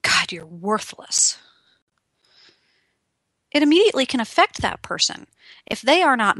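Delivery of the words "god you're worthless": slow, low, scornful put-down; slow, cutting contempt; contemptuous put-down. Acted. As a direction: sneer it out slowly like they're nothing. Talking down to someone.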